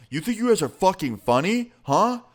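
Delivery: retelling the guy's rage, pitch shooting up on huh; imitating angry threat. Guy hit by a cup confronting them, hammed-up furious accusation. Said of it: "He was seeing red".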